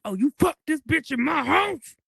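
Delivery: high, very loud imitation of a furious woman; loud, high-pitched mock outrage; retold outraged outburst. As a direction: re-enact her shrieked accusation at full volume.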